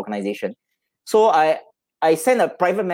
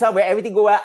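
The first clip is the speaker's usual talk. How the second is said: usual speaking voice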